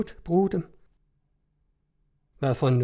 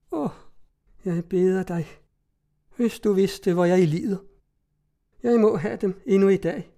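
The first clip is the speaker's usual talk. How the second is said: higher, a touch of tears in the plea; tearful pleading; 4 semitones higher than usual. A narrator's reading. The tormented cousin begs for the money, lightly tearful. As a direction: plead as if near crying, understated. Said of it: "Hun græd og stammede".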